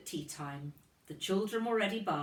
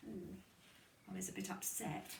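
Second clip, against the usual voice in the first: quiet aside; 11 dB quieter than usual. Real, not acted. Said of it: "aside to children".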